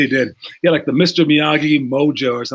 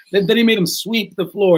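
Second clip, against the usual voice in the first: usual speaking voice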